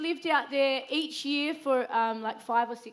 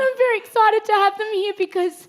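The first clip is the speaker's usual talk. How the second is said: choked up, laughing through tears; fighting back happy tears; teary; 9 semitones higher, 12 dB louder than usual. Real, not acted. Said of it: "sorry, I'll get all teary".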